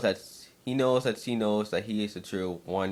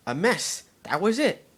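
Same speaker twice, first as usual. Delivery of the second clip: excited realization; 10 semitones higher than usual. Acted. Fluttershy realizes the answer.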